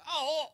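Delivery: high, drawn-out "oh"; mock pained cry. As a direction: imitate a yelp of discomfort. Acts out his reaction to washing hands.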